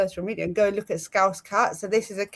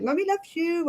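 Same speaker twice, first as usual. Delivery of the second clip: high, doting pet-parent voice; doting mummy voice; 9 semitones higher than usual. Retold or imitated. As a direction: coo it high to your little one. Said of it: "when I'm even saying to her".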